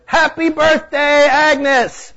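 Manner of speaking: high, loud, drawn-out birthday yell; crowd yell. A narrator's reading. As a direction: belt it out like a roomful of partygoers. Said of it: "Everybody yelled together".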